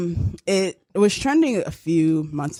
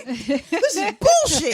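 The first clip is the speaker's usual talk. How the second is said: slow whiny entitled complaint, a bit higher; mad Chad; 7 semitones higher, much slower than usual. Retold or imitated. Mocking a Chad angry the bar ran out of pale ale, slow and whiny. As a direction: drawl it out like a spoiled guy.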